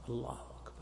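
hushed, voiceless whisper; barely audible to oneself. Shown as a demonstration. Teacher showing students the least audible takbir, whispered. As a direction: whisper it only for your own ears. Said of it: "يعني إسماعه نفسه".

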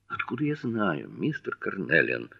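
hushed flare of irritation; flaring up. A narrator's reading. Mrs Underwood flares at Jherek, in a quiet indignant retort. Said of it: "вспыхнула она, сверкая глазами на Джерика".

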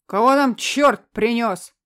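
loud, slow gurgling bellow. Acted. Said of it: "раздался булькающий голос".

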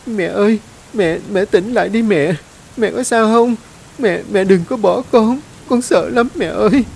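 put-on a small child begging her mother to wake; child crying for mother. A narrator's reading. Child begging unconscious mother to wake, high frantic cry. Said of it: "Tôi gạt nước mắt lay mẹ dậy".

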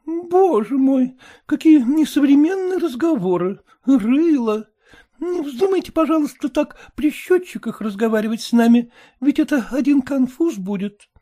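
put-on high, cheeky girl's voice; high mocking daughter; pert young daughter. A narrator's reading. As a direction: tease the mother lightly.

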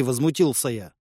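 ordinary delivery, nothing marked

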